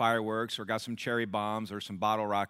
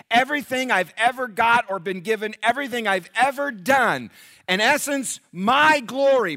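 much higher, much louder, building preaching; impassioned Paul; 12 semitones higher, 11 dB louder than usual. Acted. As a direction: build Paul's list to a ringing peak. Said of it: "Paul said".

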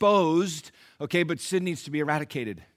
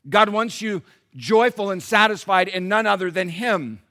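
ordinary talk, nothing marked

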